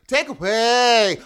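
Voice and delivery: put-on loud, slow, odd Euro accent on "Take away."; hammed-up imitation of Wiseau's accent; mock Tommy Wiseau accent. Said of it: "he'll be like".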